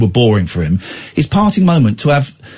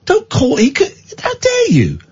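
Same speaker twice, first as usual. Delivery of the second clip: indignant; 10 semitones higher, slower than usual. Retold or imitated. Fuming at the receptionist.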